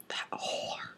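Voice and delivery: very soft whispered jibe; whispered aside. Real, not acted. Reader judging Sebastian mid-story, whispered insult. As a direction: whisper it under the breath. Muttered aside about the character.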